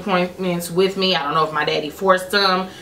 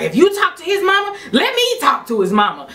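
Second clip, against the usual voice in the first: high, insistent demand; acted-out bossy scolding; imitated angry dad; 11 semitones higher than usual. Dad demanding boyfriend's mama, angry. Father's angry lecture continues.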